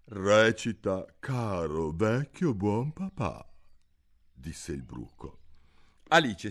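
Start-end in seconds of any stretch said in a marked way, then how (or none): 0.0-3.5 lightly voiced caterpillar, slower and a touch louder. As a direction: command it lazily. Il bruco ordina. (il bruco ordina, lento)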